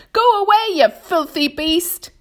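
high, loud yell at the shark; shouting. A narrator's reading. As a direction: yell to chase the beast away. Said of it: "they shouted".

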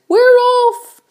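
very high, loud cheer; excited cry. A narrator's reading. Others echo the centipede, loud excited cry. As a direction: shout it high like an excited crowd. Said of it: "the others cried".

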